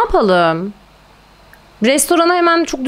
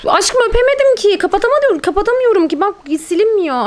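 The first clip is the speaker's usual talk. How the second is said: frustrated whine; 10 semitones higher than usual. Real, not acted. Frustrated whining at game.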